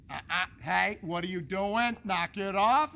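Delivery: loud sharp scold, rising on knock it off; scolding the dog. Retold or imitated. Owner scolding the dog when grandma comes over, loud. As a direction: bark it at the dog like a fed-up owner. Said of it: "you're like".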